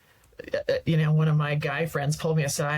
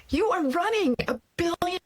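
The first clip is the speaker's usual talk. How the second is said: friend's scolding; 11 semitones higher than usual. Retold or imitated. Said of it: "And he goes".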